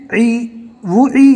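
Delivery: وو ای said slowly with the sounds held apart; slow spelling demo. Shown as a demonstration. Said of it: "اس طرح آپ کو ہجے کرنا ہے".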